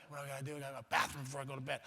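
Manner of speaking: low, hushed, quick self-reminder; muttering to himself. Retold or imitated. Kid reminds himself at bedtime, hushed mutter. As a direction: mutter it quickly under your breath.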